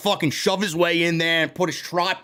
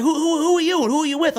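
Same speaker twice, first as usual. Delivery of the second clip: high, fast, put-on voice of a pushy reporter; mock pushy reporter; 9 semitones higher, faster than usual. A reporter demanding to know who the streamer works for, fast and high. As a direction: rattle the question off like a snooty stranger. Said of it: "He's like".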